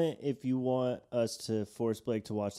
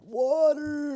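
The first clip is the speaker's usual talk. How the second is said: much higher, strained shout of need; desperate SpongeBob cry. Retold or imitated. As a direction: gasp it out like SpongeBob drying up. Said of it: "he's like".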